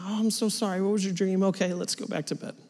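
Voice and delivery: put-on hushed, soft reassurance to a child; gentle comforting. Dad comforting scared daughter after bad dream, hushed. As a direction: soothe her back to sleep quietly.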